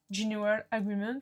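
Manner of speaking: slow, self-mocking English accent on the acronym. Shown as a demonstration. Lecturer jokingly shows off to students, slow English accent. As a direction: spell out the English name in a proud English accent. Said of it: "avec mon super accent anglais".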